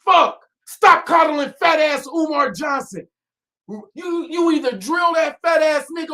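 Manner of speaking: high, loud furious ranting; shouting in real anger; angry shouting. Real, not acted. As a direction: yell your outrage at the audience. Angry rant opening, shouting.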